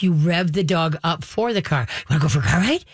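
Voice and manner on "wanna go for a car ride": building from chirpy to very high and excited; climbing into a squeaky, giddy sing-song; revving up excitement. Shown as a demonstration. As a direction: hype the dog up more with each line. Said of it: "You get the all dog all nutsy".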